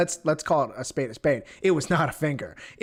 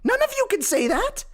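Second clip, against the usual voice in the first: high, pealing crow of triumph; exultant boasting; 11 semitones higher than usual. Acted. Comedian boasts to viewers about his clean results, crowing exultantly. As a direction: brag at the top of your range, challenging everyone.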